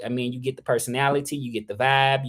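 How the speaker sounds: normal talking voice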